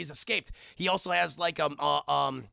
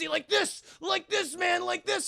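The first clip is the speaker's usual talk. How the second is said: worked up; 11 semitones higher than usual. Retold or imitated. Slamming gun into guy's face.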